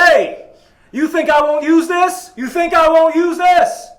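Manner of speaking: high, very loud acted scream; threatening yell. Robber-like customer waves a gun at the bakery staff, yelling threats. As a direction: bellow the threat full out, stretching the words.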